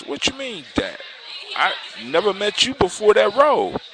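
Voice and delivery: high-pitched, puzzled prince; baffled protest. A narrator's reading. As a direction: protest high, in mild storybook disbelief.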